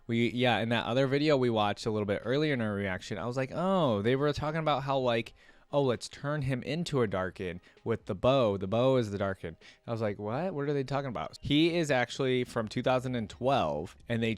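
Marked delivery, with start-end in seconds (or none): none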